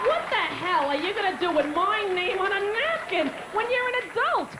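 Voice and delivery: high, rising mock outrage; exasperated rant. Comic berates adult autograph seekers, high exasperated rant.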